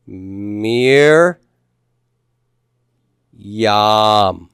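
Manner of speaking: MIR... YAM, each syllable drawn out with a long gap; syllable-by-syllable pronunciation. Shown as a demonstration. Teacher showing class how we say this, syllable by syllable. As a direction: stretch out "Mir", pause, then "yam". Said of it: "how would we say this".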